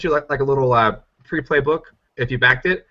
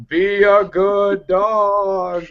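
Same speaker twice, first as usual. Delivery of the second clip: slow, higher cooing voice, like praising a pet; cooing to a dog; 8 semitones higher, much slower than usual. Retold or imitated. Collie art quoted, petting voice.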